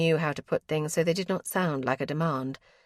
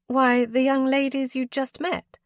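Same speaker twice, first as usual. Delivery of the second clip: higher, a touch exasperated; mild surprise; 7 semitones higher, 4 dB louder than usual. A narrator's reading. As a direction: remind him with light surprise. Cousin surprised.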